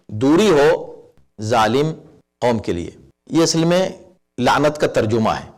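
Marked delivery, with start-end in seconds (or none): 0.1-2.9 slow solemn. Shown as a demonstration. Slow solemn translation of verse.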